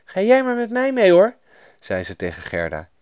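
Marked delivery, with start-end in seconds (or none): 0.0-1.4 put-on high, friendly little girl's voice; nice young robber girl inviting a new friend. A narrator's reading. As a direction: play a kind child, pitched well up. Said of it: "een aardig klein roversmeisje".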